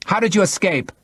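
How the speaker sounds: high-pitched, harsh demand from a woman. A narrator's reading. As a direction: demand an answer sharply. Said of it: "she asked harshly".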